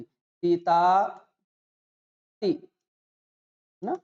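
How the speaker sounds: सीता said with gaps, syllable by syllable: सी... ता... ती... ना; split apart. Shown as a demonstration. Teacher showing the wrong, broken way. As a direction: stretch सीता into separate pieces with pauses. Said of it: "ऐसा उच्चारण अगर कर रहे हो".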